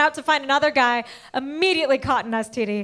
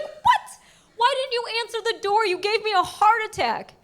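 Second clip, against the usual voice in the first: very high-pitched, exasperated disbelief; high incredulous telling-off; shocked scolding; 8 semitones higher than usual. Retold or imitated. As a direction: squeal the what, then scold up high without shouting. Scolds him for not answering door.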